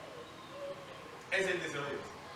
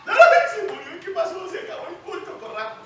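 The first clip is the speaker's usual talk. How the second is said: much higher, loud, laughing cry; mock panicked cry; 12 semitones higher, 12 dB louder than usual. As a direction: act out the panic in the church. Mimics panicked worshippers. (grito de pánico fingido)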